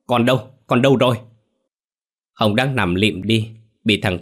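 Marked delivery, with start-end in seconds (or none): 0.1-0.5 loud, higher desperate cry; panicked yell; frantic shout. Acted. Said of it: "hét lên". 0.7-1.2 louder and higher, building panic; desperate screaming question; louder frantic shout. Acted. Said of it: "hét lên".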